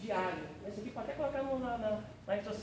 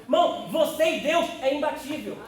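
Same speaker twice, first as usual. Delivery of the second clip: rising, louder preaching call; fervent preaching; 3 semitones higher, 6 dB louder than usual. Acted. Preacher urging the congregation they are capable, fervent and louder. Preaching builds to full force.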